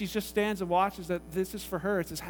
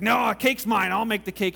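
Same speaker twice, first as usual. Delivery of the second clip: loud, slightly raised protest; loud insistence; 3 semitones higher, 10 dB louder than usual. Retold or imitated. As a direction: cut in loudly to claim the job. Harry insisting on baking the cake.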